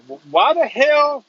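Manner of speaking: loud, slow, high Louisiana drawl; Louisiana accent. Retold or imitated. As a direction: imitate an incredulous Louisiana policeman, drawn out. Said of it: "this is in your best Louisiana accent".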